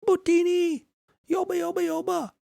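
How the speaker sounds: very high squeaky alien gibberish; tiny desert scavenger jabbering nonsense; Jawa gibberish. Pretend. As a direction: chatter like a little Jawa. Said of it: "like a little Jawa in Star Wars".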